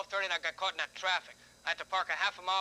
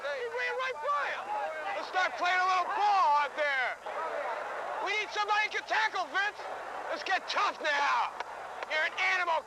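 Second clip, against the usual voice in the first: yelling from the stands; 11 semitones higher than usual. Real, not acted.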